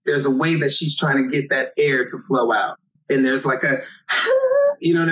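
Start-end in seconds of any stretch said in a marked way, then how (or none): 4.1-4.7 very high sustained vocal cry; short wordless high-pitched singer's wail; high belted note. Shown as a demonstration. Demonstrates sound of a belting singer.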